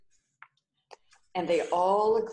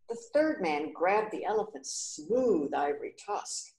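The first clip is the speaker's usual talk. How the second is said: ordinary delivery, nothing marked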